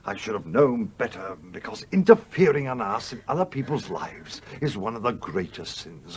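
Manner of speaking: ordinary talk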